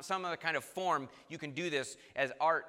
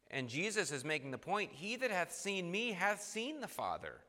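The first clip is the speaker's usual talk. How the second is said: ordinary delivery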